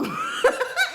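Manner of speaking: very high, loud cackle; laugh. Real, not acted. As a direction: burst into squealing laughter. Laughs at her own telling.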